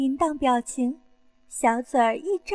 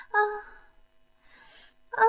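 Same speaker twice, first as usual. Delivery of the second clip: two drawn-out moans; breathy moan between lines; acted moaning; 7 semitones higher than usual. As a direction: sigh out in pleasure. Acted moans within the story.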